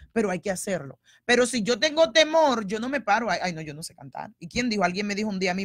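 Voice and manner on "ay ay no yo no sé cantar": mock timid excuse. Mimics a fearful excuse.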